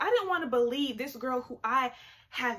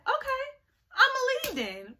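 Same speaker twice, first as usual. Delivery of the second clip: very high, sing-out acted reply; mock-breezy squeal of agreement; high-pitched okay; 13 semitones higher, 4 dB louder than usual. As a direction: pipe up high as if gladly going. Acting out eager agreement to leave.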